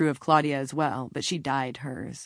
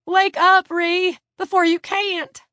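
very high, loud pleading cry; desperate cry; 9 dB louder, slower than usual. A narrator's reading. Claudia shaking Ree harder before it is too late, desperate cry. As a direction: cry out as if time is running out. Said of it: "Claudia cried, shaking harder".

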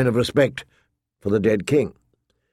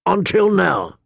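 short retort with a touch of rasp; a hint of the hound's rasp, pitched up; deep rasp; 7 semitones higher than usual. A narrator's reading. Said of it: "the hound said in his deep rasp".

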